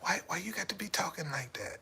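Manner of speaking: whispered wife complaint in bed; wife whispering a complaint in bed. Retold or imitated. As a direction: hush it like a nagging wife in bed. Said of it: "as soon as we got in bed".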